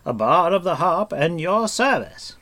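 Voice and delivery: high, loud flourish rising grandly; courtly introduction. A narrator's reading. Bard bowing and offering Taran his service, grandly. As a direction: present yourself with a bardic flourish. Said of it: "bowing deeply".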